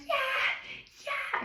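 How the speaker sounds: very high, gushing "Yeah"; overly eager agreeing; hammed-up keen nodding voice; over the top yeah. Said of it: "Okay, that's over the top".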